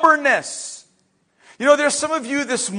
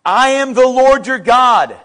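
loud, firm scripture proclamation; emphatic declaration; 2 semitones higher, 8 dB louder than usual. Acted. Preacher proclaims God's words to congregation, emphatic. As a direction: declare it boldly in one sweep.

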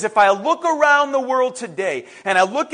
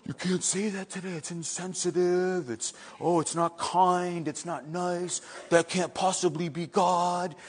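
hushed, lower, scandalised protest; mock offended objection; 4 semitones lower, 10 dB quieter than usual. Preacher mimics people offended by "deserved", mock scandalised. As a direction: imitate a delicate objector, much softer.

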